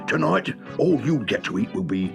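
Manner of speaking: high, furious scolding, easing at the end; angry threat. Acted. Mister Greasy punishing the dozy dubs, angry scolding.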